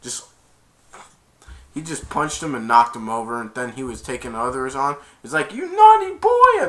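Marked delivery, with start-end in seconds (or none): 5.5-6.7 high, drawn-out loud mock scold; scolding Santa. Santa fighter to his opponent, high mock scolding. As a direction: scold in a squealed voice.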